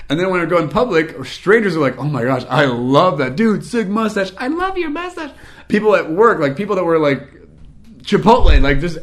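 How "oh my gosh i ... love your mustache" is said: hammed-up fan adoration; mock gushing. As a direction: imitate thrilled strangers, climbing to a squeal. Strangers gushing over the mustache.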